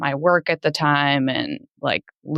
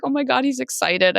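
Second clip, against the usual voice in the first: much higher, lightly amazed; pitched-up replay of a surprised thought; retold amazement; 10 semitones higher than usual. Wife recalling her husband's unexpected reaction, lightly amazed.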